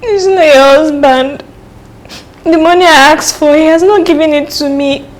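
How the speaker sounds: loud, wailing complaint; crying. Acted. Girl wanting money for exams, crying loudly. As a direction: bawl out your grievance, dragging the opening words. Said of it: "Is that why you're crying?".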